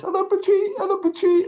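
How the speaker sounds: very high, squeaky cartoon Minion chirp. A Minion greeting the mouse Pachi, very high and squeaky. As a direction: pitch it way up like a little Minion. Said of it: "And he says".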